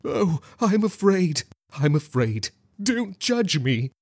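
high, drawn-out mock terror; horrified squeal. A narrator's reading. Man playing fear before the group, horrified squeal. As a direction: squeal it slowly, high and frightened. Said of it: "squealed with horror in his eyes".

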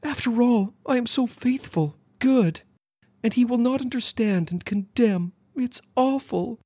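very high, plaintive mock distress; exaggerated fear. A narrator's reading. Girl exaggerating her fear of being condemned, plaintive fright. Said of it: "Alice showed her fear".

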